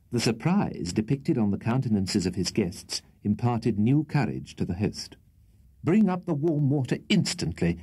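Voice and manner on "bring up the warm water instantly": raised, sternly insistent order; desperate sternness. A narrator's reading. Host ordering warm water from the servant, desperately stern. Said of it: "with desperate sternness".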